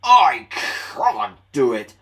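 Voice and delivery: loud alternating strained puffs; puffing engines. Acted. Both engines puffing up the hill together. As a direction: trade the lines in rhythm, straining. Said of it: "they puffed together".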